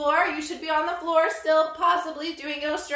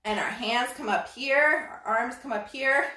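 usual speaking voice

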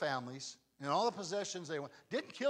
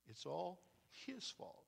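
bitter murmur; 12 dB quieter, slower than usual. Retold or imitated. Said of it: "one of them says".

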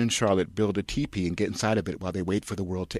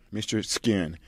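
ordinary delivery

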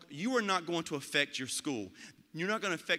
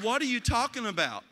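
louder, higher disbelieving outburst; incredulous; 6 semitones higher, 5 dB louder than usual. Retold or imitated. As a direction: throw it back at her in disbelief. Incredulous reply to a complaining teacher.

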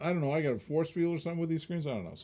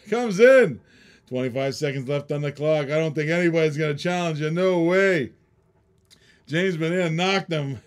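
hyped ringside commentary, higher and louder; excited play-by-play; 5 semitones higher, 9 dB louder than usual. Acted. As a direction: call the bidding like a boxing match. Mock boxing play-by-play of the bidding.

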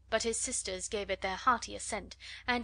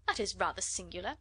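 plain reading voice

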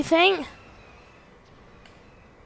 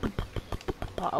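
mock droning speech; 17 dB quieter than usual. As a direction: babble fading to voiceless pops. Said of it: "I'm just listening to him speak, like".

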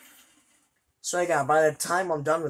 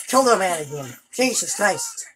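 ordinary talk

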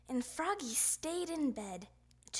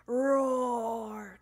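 loud, drawn-out play roar; dinosaur roar; 7 dB louder than usual. A narrator's reading. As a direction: roar it out like a T-Rex. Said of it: "cried Froggy".

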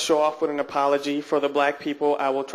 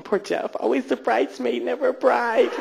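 softer, higher, pitying coo; mock pity; 7 semitones higher, 4 dB quieter than usual. Audience thinking about the unmarried comic, mock pity. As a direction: pity him in a small high voice.

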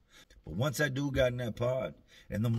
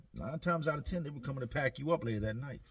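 ordinary delivery

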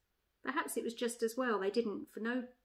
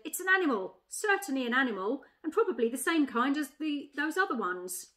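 lightly voiced bulldog, higher and louder; insistent bulldog; 4 semitones higher, 6 dB louder than usual. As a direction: argue like a bulldog sure of its find. Bulldog in a read-aloud story.

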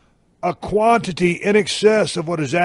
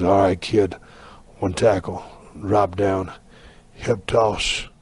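low, hushed wrestler's aside; horsefly calling spots; 10 semitones lower, slower than usual. Acted. Horsefly to the man holding him in a headlock, low secretive spot-calling. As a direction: call the moves low and terse, pausing between.

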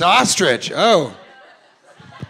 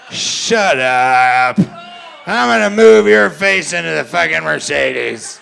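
put-on loud, rough drunken threat; mock drunk brother threatening; 7 dB louder, slower than usual. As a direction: snarl it like a drunk brother picking a fight. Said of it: "That's my impression of your family".

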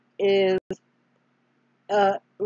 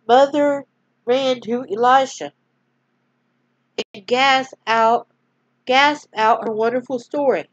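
storyteller reading aloud; story narration; 4 dB louder than usual. A narrator's reading. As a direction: gently read bedtime-story voice. Reads a Bible story aloud.

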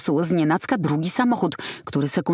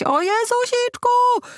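high, slow, squealing panic of a flustered driver; hammed-up imitation of a panicking woman driver; mocking shriek; 3 dB louder, slower than usual. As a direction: squeal it like she's about to crash. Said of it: "krzyczeć".

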